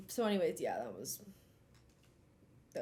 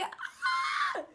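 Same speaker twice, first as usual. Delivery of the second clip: very high squealed "Ah!"; high laughing scream of secondhand embarrassment; cringe scream. Retold or imitated. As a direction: shriek out the embarrassment, half laughing. Acting out her cringing reaction.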